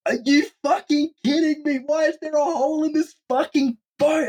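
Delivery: high-pitched exasperated rant; hammed-up squeal of outraged disbelief; swearing like a sailor. Storyteller reliving the hole in his boat, exasperated high rant. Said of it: "I'm, like, swearing like a sailor".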